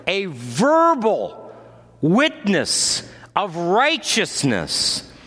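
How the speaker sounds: full-force preaching. Acted. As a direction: preach it out, building to a shout. Sermon rises to full preaching.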